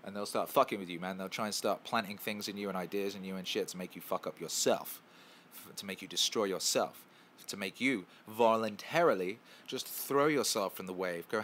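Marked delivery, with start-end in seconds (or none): none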